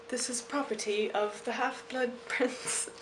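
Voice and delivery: put-on a soft, light British accent. Vlogger reading her journal's Harry Potter line in British accent. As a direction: read it out like a posh Brit, softly. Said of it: "That's my British accent".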